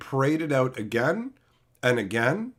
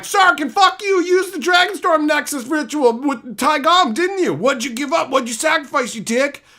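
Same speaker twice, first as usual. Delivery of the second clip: high, loud hammed-up yell at Sarkin; mock furious Narset; 10 semitones higher, 5 dB louder than usual. Streamer acts out Narset cursing Sarkin in a high furious yell. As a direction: rant like an outraged heroine. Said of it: "Narset's like".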